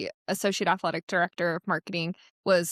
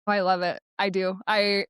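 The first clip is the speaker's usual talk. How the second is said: ordinary talk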